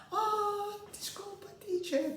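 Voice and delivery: put-on small, very high-pitched child voice; high mock-innocent squeak; apologetic pupil. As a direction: mimic a little girl saying sorry.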